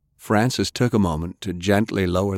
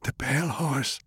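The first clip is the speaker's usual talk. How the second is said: soft, higher, failing voice; weak dying voice; 6 semitones higher, 6 dB quieter than usual. A narrator's reading. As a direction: barely get the name out. Dying grandfather gasps out confession.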